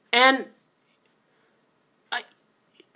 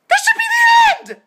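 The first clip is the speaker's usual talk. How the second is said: very high, loud, all-out shriek; furious scream; 6 dB louder than usual. Real, not acted. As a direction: lose it completely and scream. Repeats it, screaming.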